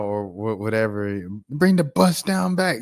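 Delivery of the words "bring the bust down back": high, loud hyped-up approval; exaggerated high-pitched enthusiasm; mock excited cheering. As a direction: cheer it on like an overexcited fan. Voicing approving fans of straightened hair.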